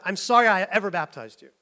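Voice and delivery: mild mock exasperation; flipped modern pastor version. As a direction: flip it into a pastor's exasperated jab. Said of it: "flip it".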